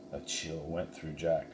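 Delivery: ordinary delivery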